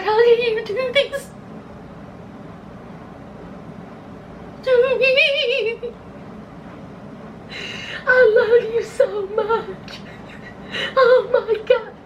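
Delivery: high, put-on crying voice with laughs; mock sobbing wife. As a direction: ham up a tearful plea. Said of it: "she was like".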